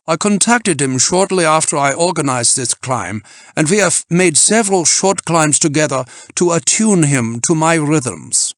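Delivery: put-on loud, high German-accented voice; German team leader asserting his command; self-important German climber. A narrator's reading. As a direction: boast imperiously with a German accent. Voices Freitag.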